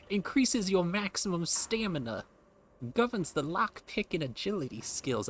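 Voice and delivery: greaser voice. Acted. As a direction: slide into a greaser swagger. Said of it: "now I just turned into a greaser".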